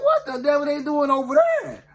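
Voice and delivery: high-pitched, acted disbelief; baffled exclamation. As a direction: exclaim in mock bewilderment. Baffled by the auction.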